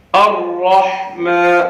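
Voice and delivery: slow الرحمن with thick ra and throaty ha; heavy ra. Shown as a demonstration. Teacher modelling الرحمن slowly. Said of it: "را کو موٹا کر کے پڑھنا ہے".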